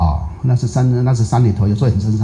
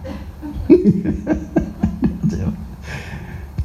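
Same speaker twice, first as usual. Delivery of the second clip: high silent laugh; 5 dB quieter than usual. Real, not acted. Laughing at the story.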